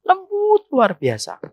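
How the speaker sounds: high, drawn-out sugary softness; slow, high, exaggerated gentleness; mock sweetness. Mimics sweet talk to other men.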